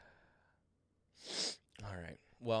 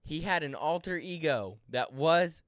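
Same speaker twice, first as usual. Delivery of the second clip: excited; 6 semitones higher, 11 dB louder, slower than usual. Real, not acted. Incredulous retelling.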